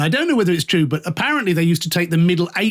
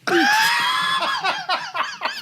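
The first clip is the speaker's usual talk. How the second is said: shrieking laugh. Real, not acted. Telling the host about a boss, shrieking laugh. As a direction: crack up at the memory. Laughing at her own outburst.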